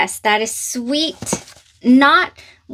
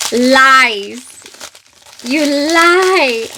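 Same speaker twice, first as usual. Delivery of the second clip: louder playful you lie; delighted disbelief; 2 semitones higher, 6 dB louder, much slower than usual. Real, not acted. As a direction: call it a lie in thrilled disbelief. Disbelief at finding fragrance.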